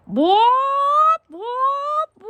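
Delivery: drawn-out high whoops after a song; high rising whoop. Real, not acted. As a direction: whoop like a squawking hen. Whooping at end of chicken song.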